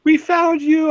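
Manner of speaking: high, loud quoted cry; big hammed-up dramatic call; emotional mock cry. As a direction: belt out the tearful reunion line, high-pitched. Quotes an emotional show line.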